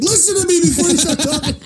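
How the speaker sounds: very high-pitched mock outburst; imitated agitated boss. Boss cutting off the client mid-explanation, imitated agitation. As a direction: cut in with shrieky mock exasperation. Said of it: "he's really fucking agitated".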